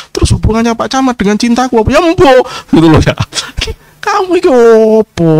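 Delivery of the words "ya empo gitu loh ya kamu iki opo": shouted rebuke, much higher and louder; scolding shout. Real, not acted. A preacher yelling at a lovesick believer, loud and exasperated. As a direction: bark the scolding at the listener.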